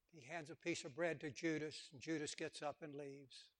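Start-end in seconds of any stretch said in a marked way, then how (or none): none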